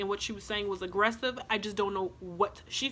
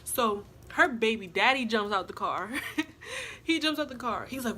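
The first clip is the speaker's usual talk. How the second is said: ordinary delivery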